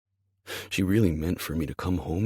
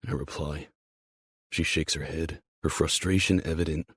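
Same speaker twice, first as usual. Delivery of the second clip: ordinary delivery, nothing marked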